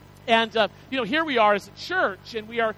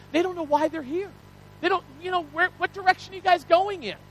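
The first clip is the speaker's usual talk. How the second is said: higher-pitched puzzled newcomer; acted bewilderment; 7 semitones higher than usual. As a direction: ask it high, as if lost and wanting direction. Voices puzzled newcomers asking for direction.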